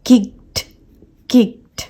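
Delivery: high "kikt" for किक्ड; one-syllable किक्ड with tiny released t; soft T ending. Shown as a demonstration. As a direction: end किक्ड between t and d, no vowel. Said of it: "इन दोनों के बीच का एक sound".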